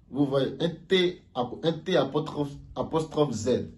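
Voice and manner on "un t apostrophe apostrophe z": ordinary talk, nothing marked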